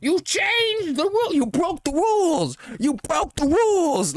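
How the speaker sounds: high, slow whining mock complaint; mock whiny fan. Mocking an imagined fan who says he broke the rules, high slow whine. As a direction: whine it like an outraged nerd. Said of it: "I wouldn't have been like".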